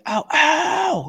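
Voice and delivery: high mock Kinison scream; hammed-up comic howl; Kinison yell. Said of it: "Sam Kinison was like".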